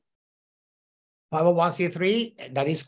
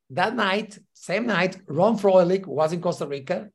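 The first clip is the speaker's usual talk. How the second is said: ordinary talk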